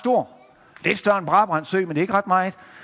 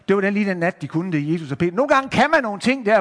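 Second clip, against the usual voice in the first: plain reading voice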